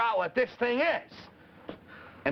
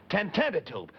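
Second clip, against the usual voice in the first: said fast. Shown as a demonstration. Showing the kids the fast way, quick. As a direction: run the name together quickly. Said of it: "we've been saying it fast like this".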